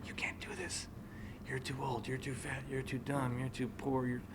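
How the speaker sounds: hushed, airy voice of self-doubt; whispered taunts in your head; inner doubt voices. Retold or imitated.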